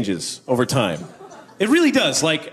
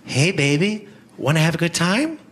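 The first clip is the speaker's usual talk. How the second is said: sultry hooker; 4 semitones higher than usual. Acted. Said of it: "A superhero being propositioned by a hooker".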